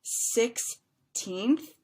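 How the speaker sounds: put-on "Sixteenth" slowly, six then a long "teenth"; stretched second syllable. Shown as a demonstration. Teacher showing two syllables, "Sixteenth" slow with stretched teenth. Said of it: "second syllable is stretched out".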